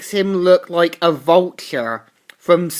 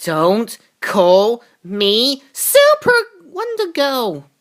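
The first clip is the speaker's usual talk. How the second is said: higher, slow drawn-out warning; mock tough heroine; 5 semitones higher, slower than usual. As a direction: stretch each word like a threat.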